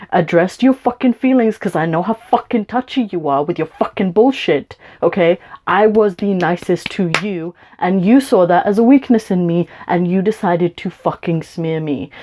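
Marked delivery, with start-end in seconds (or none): none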